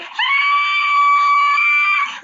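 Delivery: high piercing scream at the spider. Retold or imitated. Woman seeing a spider in the bathtub, screaming. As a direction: shriek in sudden terror. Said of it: "I scream for, like, a good minute or two".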